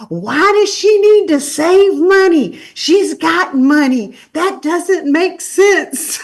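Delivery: high, loud, slow mocking critic voice; a mocking put-on voice. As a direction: sneer it like a snide commenter. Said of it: "somebody made a video".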